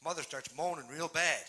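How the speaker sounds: ordinary talk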